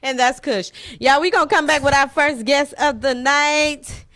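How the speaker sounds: loud, high-pitched host throw to break; show host sign-off. Real, not acted. Host tells viewers first guest is next, loud announcer sign-off. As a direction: belt out the outro to the audience.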